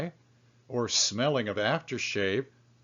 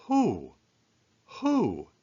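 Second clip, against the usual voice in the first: high puzzled question; puzzled who; 6 semitones higher than usual. Acted. Lonely man wondering who loved him, high and puzzled. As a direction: ask it high, mystified.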